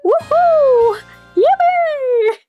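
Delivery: high, loud squealing cheer; excited cheer. Real, not acted. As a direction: cheer like a giddy fan. Cheering at game kiss.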